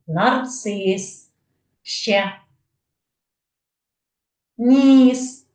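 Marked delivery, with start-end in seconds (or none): 4.6-5.5 loud answer. Acted. Child-answer echoed loudly.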